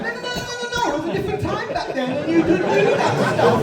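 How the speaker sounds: high, fast, flustered denial; mock panic at being accused; defensive interviewee. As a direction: squeal the denials like a cornered celebrity. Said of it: "sitting in the interview going".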